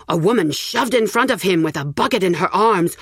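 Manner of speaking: ordinary delivery, nothing marked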